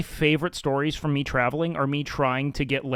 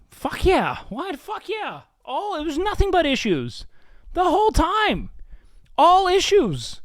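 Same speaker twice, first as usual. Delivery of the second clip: exaggerated squeal; 13 semitones higher than usual. Exaggerated voice about travel troubles.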